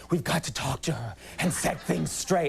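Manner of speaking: lightly caricatured insistent mother; a hint of a put-on mom voice; exaggerated mom impression. Comedian mimics mom wanting things set straight, lightly put-on mother voice. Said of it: "she doesn't really talk that way".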